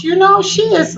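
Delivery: ordinary delivery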